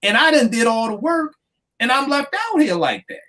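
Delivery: high, put-upon complaint as the snubbed judge; aggrieved judge voice. Retold or imitated. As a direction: whine it like the cheated lawyer. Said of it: "You'd feel some kind of way".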